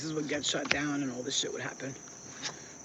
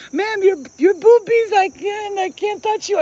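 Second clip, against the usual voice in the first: high, loud flustered stammer; a panicked man who can't look; flustered cab driver; 14 dB louder, slower than usual. Retold or imitated. Cab driver to topless passenger, high flustered stammer. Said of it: "he was like".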